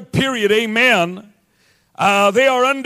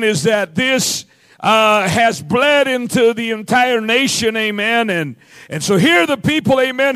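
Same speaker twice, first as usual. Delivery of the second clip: ordinary delivery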